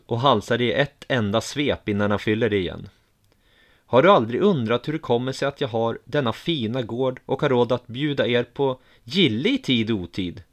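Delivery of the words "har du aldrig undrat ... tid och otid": ordinary delivery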